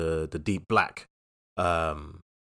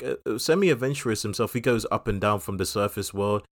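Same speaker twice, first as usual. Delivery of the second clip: ordinary delivery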